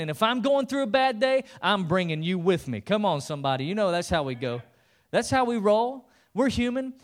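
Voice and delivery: loud, high, rising preacher call; preaching at full force. Real, not acted. Preacher fires up the congregation at full force. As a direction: whip the crowd up.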